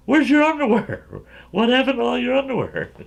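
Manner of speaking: high-pitched mock mother demanding answers; scolding mothers. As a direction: imitate a mom's high, loud questioning. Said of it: "and their mothers go".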